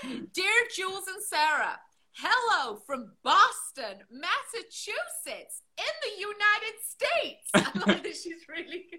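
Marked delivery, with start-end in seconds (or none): 0.0-7.5 theatrical, drawn-out card reading; letter read aloud. Acted. Reading a listener's postcard to the co-host, slow theatrical read-aloud. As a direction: lilt up and down, stretching the place names.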